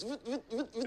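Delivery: high, clipped vut noises like a zooming lens; mechanical lens whirr. Retold or imitated. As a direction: imitate a camera lens zooming in. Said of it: "zooms in like a camera lens and goes".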